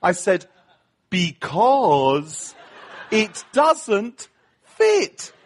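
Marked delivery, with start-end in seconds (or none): 1.1-5.3 Brit talking to foreigners. Retold or imitated. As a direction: spell it out word by word as if to a foreigner. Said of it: "the way we Brits talk to foreigners".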